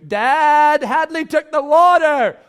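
high, loud, slow whiny tattle; child telling on sister in the hallway; child tattling. Retold or imitated. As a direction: complain like a wronged kid.

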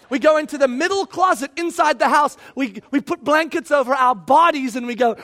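usual speaking voice

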